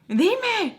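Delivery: high-pitched mock scream; retold screaming. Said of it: "Like, screaming at her".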